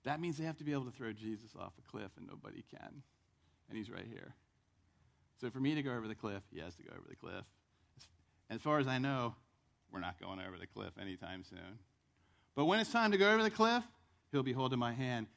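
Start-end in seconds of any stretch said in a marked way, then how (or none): none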